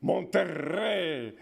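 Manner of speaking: "Monterrey" with a long trilled R; exaggerated rolled R. Shown as a demonstration. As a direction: go to town on the R in "Monterrey", slowly. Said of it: "go to town on their R".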